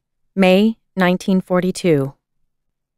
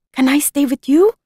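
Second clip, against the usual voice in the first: put-on a frightened girl's rising plea, higher; pleading; 7 semitones higher than usual. A narrator's reading. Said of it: "she pleaded".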